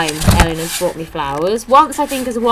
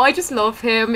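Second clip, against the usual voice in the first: ordinary talk, nothing marked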